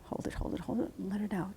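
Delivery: whispered, unvoiced breath coaching; hushed breathing cue. Real, not acted. Therapist whispers breathing cues to Gunny, hushed.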